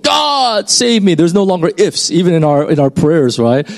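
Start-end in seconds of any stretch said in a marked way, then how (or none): 0.0-1.1 high, pleading cry for rescue; hammed-up desperate plea, pitched high; desperate cry to God. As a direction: beg God at the end of your rope. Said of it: "at the end of our rope".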